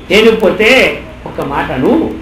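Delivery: normal talking voice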